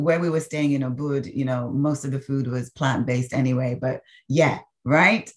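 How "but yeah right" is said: ordinary talk, nothing marked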